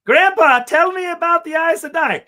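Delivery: put-on high, loud kid begging grandpa; the girl pestering grandpa, in a shrill child voice; childish voice. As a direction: squeal it like an eager child. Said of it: "it's just like".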